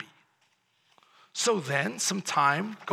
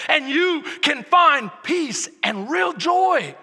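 loud, rising sermon build; full-force preaching; 6 semitones higher, 7 dB louder than usual. Acted. Pastor urges the church to live generously, preaching loudly. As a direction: drive it home from the pulpit.

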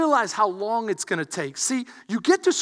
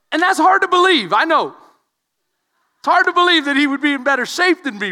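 louder preacher's punchline delivery; preaching; 7 semitones higher, 8 dB louder than usual. Acted. Pastor teasing his brother before the church, loud.

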